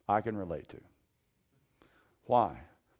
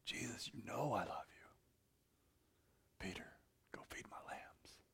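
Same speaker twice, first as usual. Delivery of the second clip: hushed whisper, much softer; whispered reply; 12 dB quieter than usual. Retold or imitated. Peter and Jesus on the shore, whispered. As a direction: breathe it out, barely voiced.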